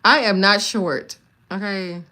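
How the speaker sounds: loud, slow, insistent; firm denial. Real, not acted. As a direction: plant each word, not short. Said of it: "insists to chat about her height".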